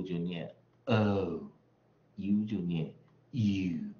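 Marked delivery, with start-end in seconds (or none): 2.2-2.4 U held long and drawn out; long vowel. Shown as a demonstration. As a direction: stretch the vowel name U, slowly. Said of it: "长".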